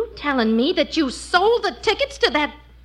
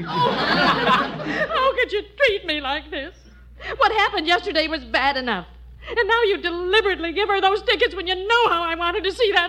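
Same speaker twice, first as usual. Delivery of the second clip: tearful; 3 semitones higher than usual. Acted. Wife blaming husband for the lost ballet tickets, crying. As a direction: reproach him through rising sobs. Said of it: "don't cry".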